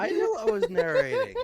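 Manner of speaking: high, loud laughing protest. Real, not acted. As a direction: laugh it out in disbelief. Laughing at forgetting narration.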